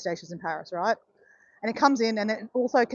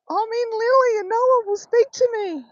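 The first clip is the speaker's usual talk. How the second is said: high, louder wail to dad; retold crying; 12 semitones higher, 5 dB louder than usual. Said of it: "And I start crying".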